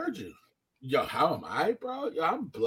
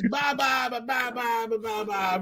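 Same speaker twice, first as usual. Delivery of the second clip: repeated "ba" imitating someone mouthing off; imitated motor-mouth babble; mock rapid-fire blabbering; 2 semitones higher, 6 dB quieter than usual. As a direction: rattle off nonsense syllables, tailing softer. Imitates a man running his mouth.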